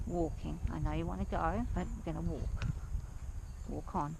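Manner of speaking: low, soft and calming, to a nervous mare; soothing horse talk. Real, not acted. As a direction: settle the horse with a low, gentle voice.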